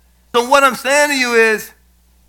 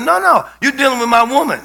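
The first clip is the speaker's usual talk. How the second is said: ordinary delivery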